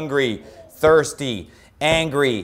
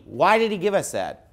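ordinary talk, nothing marked

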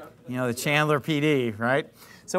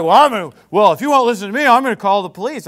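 louder put-on voice of the huffy old guy; mock threat; 3 semitones higher, 8 dB louder, faster than usual. Retelling old guy threatening to call police, mock huffy threat. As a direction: huff out the threat in a mocking voice. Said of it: "then he's like".

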